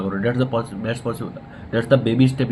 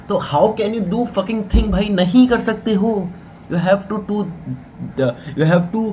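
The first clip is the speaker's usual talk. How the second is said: higher, exasperated rebuke of the viewer; exasperated scolding; 7 semitones higher, slower than usual. Real, not acted. Vlogger scolding viewers who only make plans, exasperated. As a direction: scold them for never starting, voice pitched up.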